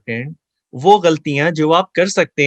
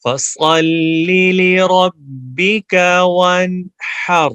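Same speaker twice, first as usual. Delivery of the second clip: slow, loud chanted recitation; Quran recitation; 4 semitones higher, 4 dB louder, much slower than usual. Shown as a demonstration. Teacher recites the verse slowly for students to repeat. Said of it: "میرے پیچھے پڑھیے آپ".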